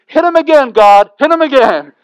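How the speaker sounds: put-on high, loud old lady yelling; old lady shouting. Old lady in the back urging God on, loud high yell. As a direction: cackle it out like a gleeful old church lady. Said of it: "an old lady in the back goes".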